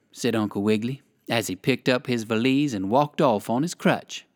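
ordinary delivery

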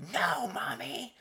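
put-on high, slow whiny toddler cry; little son yells at mother, high whine; imitating toddler. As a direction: whine like a small child. Toddler yelling at mom.

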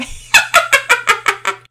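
loud high burst of laughter; cracking up. Acted. Todd laughing at his own joke, loud laughter. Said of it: "Todd cracked up".

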